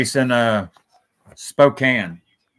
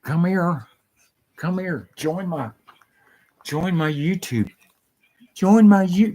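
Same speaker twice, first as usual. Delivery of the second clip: higher, sing-song coaxing of a dog; pet-talk voice; 8 semitones higher, 3 dB louder than usual. Real, not acted. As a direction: coax the puppy over sweetly.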